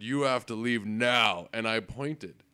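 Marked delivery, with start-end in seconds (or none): 0.0-1.5 slow, louder, dead-serious order; firm bouncer command. Retold or imitated. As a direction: stare him down and tell him to go. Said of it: "looked him dead in the eye and said".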